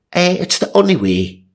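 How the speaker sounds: high hammed-up priest; priest explaining. Reader voices the priest insisting, high and hammed. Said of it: "he explained".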